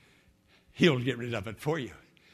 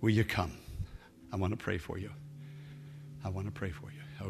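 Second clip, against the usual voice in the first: prayer; 6 dB quieter than usual. Acted. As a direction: pray softly over music. Leads a prayer.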